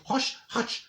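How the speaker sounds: shushing. Retold or imitated. Shushing friend at seance.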